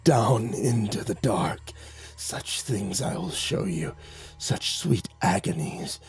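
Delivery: slow, half-whispered lure from the dark; a creature softly promising torment; menacing creature. A narrator's reading. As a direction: coax him into the dark, slowly. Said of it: "it chuckled".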